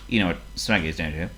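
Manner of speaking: ordinary delivery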